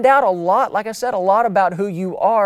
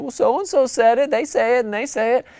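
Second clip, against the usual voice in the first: higher-pitched mock child's excuse; defensive kid; 6 semitones higher than usual. Kid explaining to mom and dad, high and defensive. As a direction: blame the others in a high protest. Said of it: "And you said".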